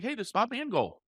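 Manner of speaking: you're gonna know who I am; familiar greeting. Shown as a demonstration. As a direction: greet them like an old pal. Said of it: "I made it sound like".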